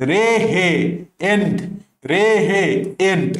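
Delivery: রে হে, then এন্ড after a pause; chunked memory aid. Shown as a demonstration. Teacher drilling what students must remember, in separated chunks. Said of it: "মনে রাখতে হবে".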